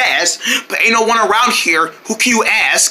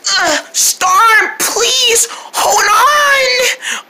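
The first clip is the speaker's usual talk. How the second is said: desperate crying plea; 10 semitones higher, much slower than usual. Acted.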